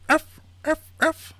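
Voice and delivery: very high, loud yaps; imitation dog bark. Englishman in a sack posing as puppies, high yapping. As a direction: yelp like a small dog. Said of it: "and the Englishman goes".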